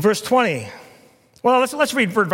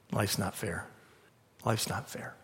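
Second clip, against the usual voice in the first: much softer, nearly whispered; hushed punchline; 8 semitones lower, 14 dB quieter than usual. Acted. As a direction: let it land quietly. Preacher drops voice for punchline.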